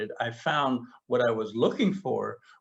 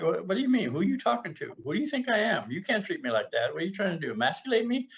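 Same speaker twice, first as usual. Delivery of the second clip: higher, indignant self-defense; bristling mock protest at partner; retold defensive outburst; 7 semitones higher, faster than usual. Mock indignant reply to partner, higher.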